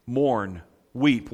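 usual speaking voice

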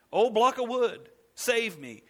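mock the worshipper begging a log; mock prayer. Preacher mocking the idolater's prayer to a block of wood.

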